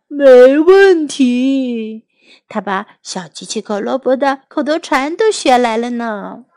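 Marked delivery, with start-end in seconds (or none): 0.1-2.0 very slow, stretched drowsy voice; hammed-up sleepy drawl. A narrator's reading. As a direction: drag out each syllable as if dozing off. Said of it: "泰迪很困倦地说".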